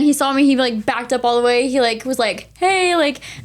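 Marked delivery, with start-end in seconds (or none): 2.6-3.2 bright high hey; excited greeting. Retold or imitated. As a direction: call it out cheerfully. Player greeting from tunnel.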